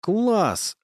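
put-on high girlish bark. A narrator's reading. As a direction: bark it like a thrilled little girl. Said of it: "рявкнула Варюша".

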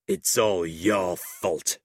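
slow, grudging blame; angry. A narrator's reading. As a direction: drag out the blame, bitter. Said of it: "the schoolmaster said angrily".

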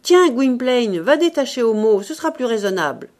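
loud, brisk order; Ursus. A narrator's reading. Ursus ordering the imagined young man, brisk command. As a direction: send him off briskly. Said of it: "Et il ajouta".

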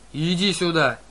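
loud enraged howl; howling rage. A narrator's reading. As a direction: howl it like a wounded giant. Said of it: "взвыл Полифем".

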